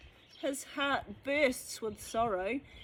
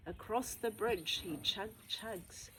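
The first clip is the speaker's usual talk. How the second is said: ordinary delivery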